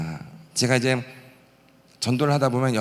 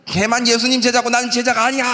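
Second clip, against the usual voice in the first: wailing complaint; 9 semitones higher, 4 dB louder than usual. Retold or imitated. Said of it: "하면서 막 울고불고".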